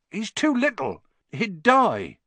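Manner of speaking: high, pleading protest; distressed protest. Acted. Hagrid defending his baby dragon from release, distressed.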